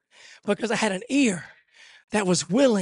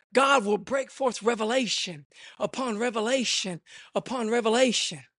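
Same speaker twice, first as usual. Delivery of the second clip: ordinary delivery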